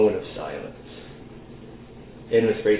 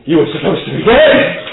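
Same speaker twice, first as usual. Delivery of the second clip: louder, rising accusing shout; startled accusation; 5 semitones higher, 8 dB louder than usual. Acted. Animus confronting Faust at the gate, shocked shout. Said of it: "points at Faust and says".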